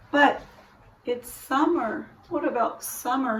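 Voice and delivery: high, soft, quiet little brother voice; a small boy wistfully asking. A narrator's reading.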